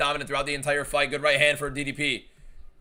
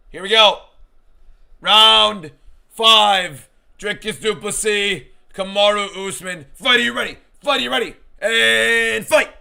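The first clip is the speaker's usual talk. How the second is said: loud, high hype announcer call; booming fighting game announcer; 7 semitones higher, 9 dB louder, slower than usual. Pretend. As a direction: boom it out like an arcade fight intro. Announces round five like ring announcer.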